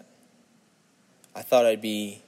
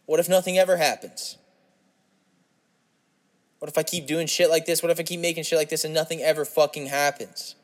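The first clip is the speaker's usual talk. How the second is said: raised, anxious what-ifs; anxious outburst; 5 semitones higher than usual. Real, not acted.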